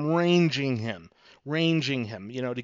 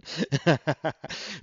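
short chuckle at own story; amused laugh. Real, not acted. Teacher amused by the panicked salesman, amused laugh. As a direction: laugh after the punchline.

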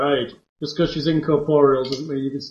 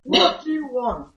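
high ghostly demand; angry ghost; 9 semitones higher, slower than usual. Acted. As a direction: spit it out as a ghost. GM voices ghost Agatha.

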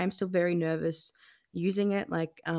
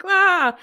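a short, loud, high shriek of panic; mock panic scream; 12 semitones higher, 12 dB louder than usual. To her psychologist, a loud high mock scream of panic. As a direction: yelp it out like sudden dread. Panicking about telling the event.

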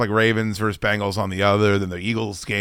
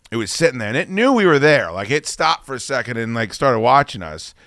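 ordinary delivery

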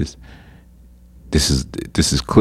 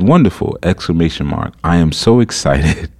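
gushing, extra gullible. Acted. Woman reads out her reply to the suspected scammer, gushing gullibly. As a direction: play the eager, gullible mark, slightly overdone. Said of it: "I don't even talk like that".

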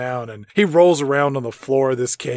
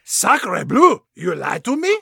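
put-on French accent; 7 semitones higher than usual. French exclamation, mock outrage.

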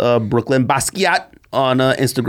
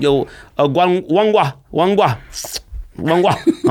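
higher-pitched, hammed-up Jamaican greeting; mock Jamaican accent; 5 semitones higher, slower than usual. Host mimicking how Top Boy characters talk, mock Jamaican accent. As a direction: imitate Jamaican patois, repeating the greeting. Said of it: "the Jamaican and the English accents".

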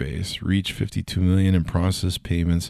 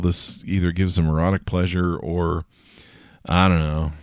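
usual speaking voice

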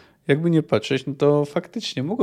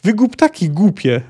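scolding, raised exclamation; narrated yell; 5 semitones higher, 5 dB louder than usual. A narrator's reading. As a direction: tell them off in a higher voice. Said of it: "wrzasnęła z trzaskiem". (umiarkowany wrzask w narracji)

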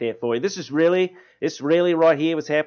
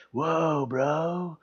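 a hint of a stoner; lightly voiced laid-back bro; stoner dude voice; 4 semitones higher, 6 dB quieter than usual. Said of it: "a dude version of that".

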